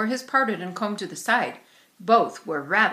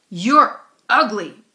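louder, slow, spiteful jab; bully taunt; 11 dB louder, much slower than usual. A narrator's reading. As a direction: spit each word slowly at her. Bully insulting Ramona.

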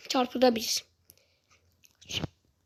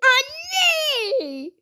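high startled cry of Anne; panicked cry for mum; 9 semitones higher than usual. Real, not acted. Gamer nearly hit, crying out for mum. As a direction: yelp it out in fright.

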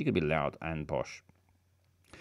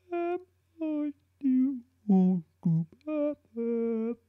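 high character voice; 3 dB louder, much slower than usual. Acted.

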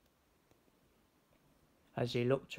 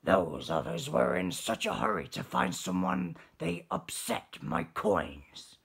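low, loud grumbling lobster; grumpy lobster; 6 semitones lower, 4 dB louder than usual. Acted. Lobster complains about others.

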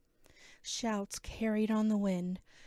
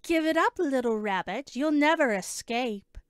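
loud, higher-pitched shout at fleeing prey; rough-voiced villain shouting after someone; rough taunting shout; 4 semitones higher, 8 dB louder than usual. A narrator's reading. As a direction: yell it as a rough pursuer taunting the runaway. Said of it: "shouted a rough voice".

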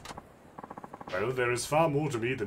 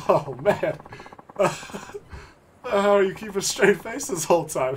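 high and loud, laughing through the words; laughing disbelief; 8 semitones higher, 6 dB louder than usual. Real, not acted. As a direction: barely get it out for laughing. Still laughing at the noble.

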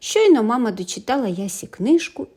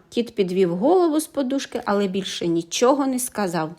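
ordinary delivery, nothing marked

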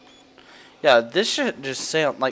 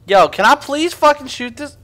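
1 frustrated yell; 9 semitones higher, 8 dB louder than usual. Real, not acted. Frustrated yelling at the game.

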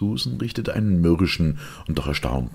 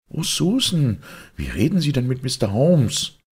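high-pitched scandalised exclamation; shocked reproach; 12 semitones higher, 5 dB louder than usual. Acted. Mistress rebukes maid for rudeness, shocked and high. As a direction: scold the maid, aghast.

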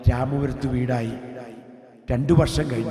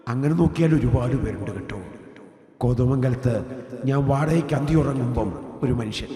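normal talking voice

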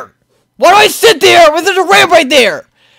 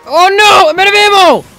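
high, alarmed call; panicked cry; 4 semitones higher than usual. Real, not acted. Player out of ammo, panicked cry. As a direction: cry out in dismay.

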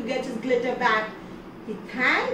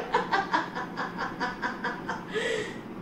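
mocking laugh; 7 semitones higher, 8 dB quieter than usual. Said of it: "he started laughing".

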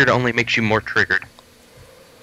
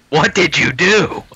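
put-on voice; 7 semitones higher, slower than usual. Teasing in put-on voice.

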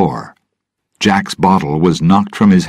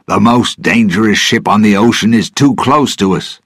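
urgent call to the crew, higher; 5 semitones higher, 4 dB louder than usual. A narrator's reading. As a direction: raise your voice to warn the sailors. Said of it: "Gibbs called to the other sailors".

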